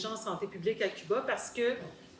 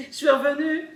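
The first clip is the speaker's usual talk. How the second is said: laughing; 6 semitones higher, 8 dB louder than usual. Real, not acted. Recounting her escape, amused.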